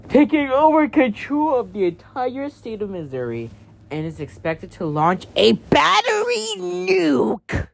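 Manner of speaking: formal emergency announcer read; news alert broadcast. Acted. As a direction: announce a severe warning to the whole state.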